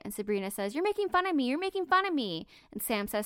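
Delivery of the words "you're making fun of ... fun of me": high-pitched, quick accusing repeat; shrill accusation. Retold or imitated. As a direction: hurl the same accusation twice, higher. Sabrina accusing Sophie, read aloud.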